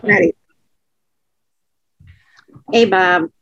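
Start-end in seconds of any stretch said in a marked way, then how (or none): none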